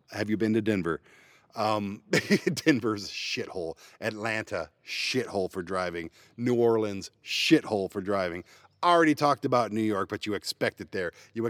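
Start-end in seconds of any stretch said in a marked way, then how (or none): none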